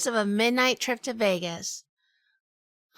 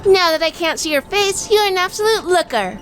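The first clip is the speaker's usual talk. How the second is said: higher, louder sarcastic compliment; the wife mocking Jack's looks, higher and louder; teasing jab; 9 semitones higher, 5 dB louder than usual. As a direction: needle him with a backhanded compliment. Teasing husband.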